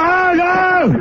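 high, drawn-out angry outburst; exasperated yell. Real, not acted. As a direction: lose patience at being interrupted. Host interrupted, yells over noisy callers. (éclat exaspéré, aigu et traînant)

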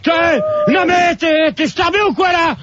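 high, outraged telling-off; real furious shouting. Real, not acted. Host berates colleague for taking listeners' addresses, furious shouting. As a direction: yell accusingly, pitched far above normal talk.

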